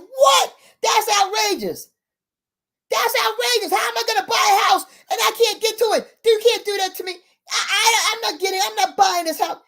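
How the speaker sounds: high-pitched, indignant mock protest; outraged. Buyer learns he has no road access, retold high outrage. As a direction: act out an incredulous, outraged rant.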